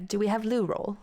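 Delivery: "loo" stressed with a falling tune; British question tune without the accent, peak on "loo"; British intonation. Shown as a demonstration. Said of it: "I can't actually do the accent part of it. It's just the, the intonation".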